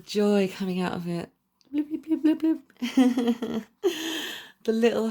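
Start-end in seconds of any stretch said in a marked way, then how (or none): 1.7-3.6 high playful blip noises for popping hearts; cartoon blips. Reader voicing popping hearts on the card, high playful blips. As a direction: chirp little blips, much higher.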